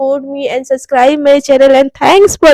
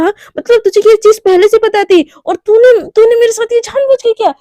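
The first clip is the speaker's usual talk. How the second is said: high, loud outraged demand; angry accusation; 7 semitones higher, 4 dB louder than usual. Acted. Romi accuses Mishka of knowing all along, outraged. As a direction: confront her betrayal head on.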